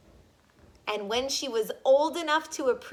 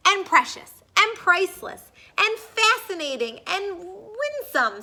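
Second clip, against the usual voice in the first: higher, louder gush; higher, louder rapturous list; 8 semitones higher, 8 dB louder than usual. Acted. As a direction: pile on the praise. Father gushing, higher louder.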